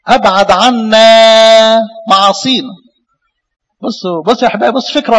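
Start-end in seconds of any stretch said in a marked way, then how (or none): 0.1-2.7 slow solemn scripture reading. Acted.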